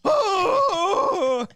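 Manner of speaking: very high, loud cartoon yell; cartoon scream. Friends doing SpongeBob voices, loud cartoon yell. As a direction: shriek his name in panic.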